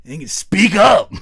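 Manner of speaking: loud, high-pitched demand to speak up; mock impatient shout. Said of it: "it's starting to become like".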